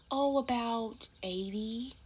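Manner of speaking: mom's fake-casual lie. Mom lies to her daughter about the money, high and slow. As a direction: play the mother brushing it off, a falsely light tone.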